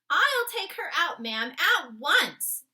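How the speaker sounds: loud, high urgent offer; the maid insists on throwing her out. Acted. Maid eager to remove intruder.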